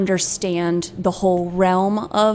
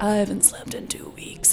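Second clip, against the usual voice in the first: whispered, much softer mock-sad voice; imitating sad insomniac; 9 dB quieter than usual. Playing back a sleepless consumer's testimony, imitated sad whisper. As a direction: whisper it like a worn-out sufferer. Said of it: "they're, like, so sad".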